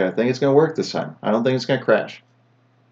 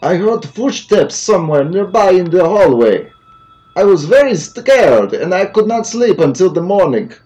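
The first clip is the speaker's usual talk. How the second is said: higher, louder hammed-up Russian accent; 7 semitones higher, 9 dB louder than usual. Reading the hospital note in a louder, higher, hammed-up Russian accent. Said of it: "My Russian accent is way off".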